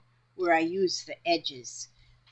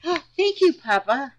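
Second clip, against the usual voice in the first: high, loud delighted gasp of thanks; light up and thank him; surprised, grateful thanks to Papa; 7 semitones higher, 8 dB louder than usual. Real, not acted.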